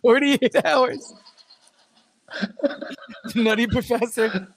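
high voice, reading a list through laughter; high-pitched, halting, giggly list reading; laughing. Real, not acted. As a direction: struggle through the titles while cracking up. Reading list while cracking up.

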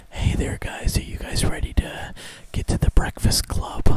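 whispered, breathy radio host. Pretend. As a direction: croon it like a late-night DJ. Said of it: "I like your A M radio voice".

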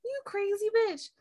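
high-pitched, incredulous squeal; mock disbelief. Reacting in disbelief to the character.

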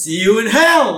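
loud, high mock yell; Reverend Lovejoy. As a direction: hurl it out as a parting shot. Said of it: "And then Reverend Lovejoy".

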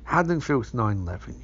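ordinary talk, nothing marked

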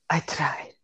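hushed dying whisper. Retold or imitated. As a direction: breathe it out, barely there. Dying Inigo apologizing to father.

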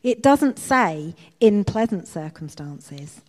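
loud, slow, emphatic correction; correcting. A narrator's reading. Betsy corrects her sister's refusal to give thanks, correcting. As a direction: set her straight, slowly and firmly. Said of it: "Betsy corrected".

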